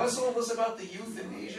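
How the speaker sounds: a laughing nod to Emily Litella's indignant outrage. Retold or imitated. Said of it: "Emily Litella".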